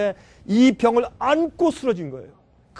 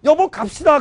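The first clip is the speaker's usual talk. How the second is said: wife urging; 6 semitones higher, 7 dB louder than usual. Retold or imitated. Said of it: "우리 집사님 어디".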